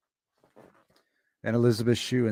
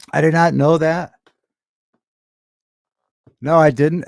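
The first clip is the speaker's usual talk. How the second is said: surprised; 4 semitones higher, 10 dB louder than usual. Real, not acted. Surprised by release date news.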